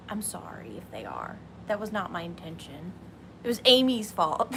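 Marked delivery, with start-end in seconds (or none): 0.1-1.4 unvoiced whispered apology; hushed whisper meant only for the chat. Real, not acted. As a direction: breathe it out as a secret aside.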